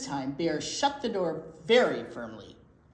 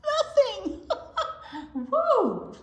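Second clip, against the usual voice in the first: laughing; 5 dB louder, slower than usual. Real, not acted. Reader delighted, mouse gone.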